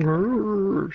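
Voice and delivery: high, hammed-up angry growl; mock rage roar. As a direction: let out a cartoon roar. Mock fan outburst.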